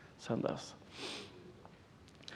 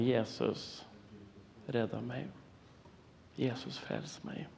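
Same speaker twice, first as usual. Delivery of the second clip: retold tearful prayer; 12 dB quieter, slower than usual. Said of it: "Gråtande säger jag det".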